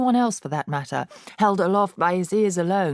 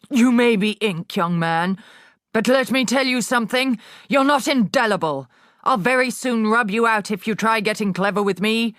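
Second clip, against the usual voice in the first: higher, louder menacing headmistress; threatening headmistress; 5 semitones higher, 4 dB louder than usual. A narrator's reading. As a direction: warn him you'll rub him out. Trunchbull threatens Eric.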